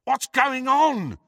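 high, drawn-out call; shouting. A narrator's reading. Friend beyond the junk wall, shouting. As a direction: call the question over a wall. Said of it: "shouted Ron again".

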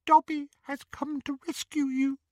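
high squeaky elf; house elf voice. Acted.

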